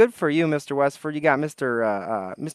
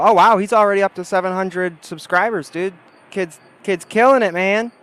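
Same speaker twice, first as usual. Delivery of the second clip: higher and louder, genuinely impressed; excited wow; 6 semitones higher, 8 dB louder than usual. Real, not acted. Impressed by subscriber count.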